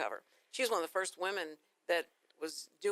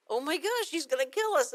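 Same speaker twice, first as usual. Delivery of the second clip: higher, panicky voice for the fleeing dogs; panicked dogs; 8 semitones higher, 4 dB louder than usual. Pretend. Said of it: "the dogs are going like".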